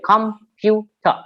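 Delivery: "computer" broken into three separate beats; syllable by syllable. Shown as a demonstration. Teacher showing a trisyllabic word, syllable by syllable. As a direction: say "computer" as com, pu, ter with gaps. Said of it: "trisyllabic words like".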